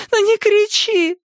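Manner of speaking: put-on high, slow, loud childlike plea; crying child. A narrator's reading. Girl crying to her mother, high tearful plea. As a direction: beg her mother through tears. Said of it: "плакала Мадда".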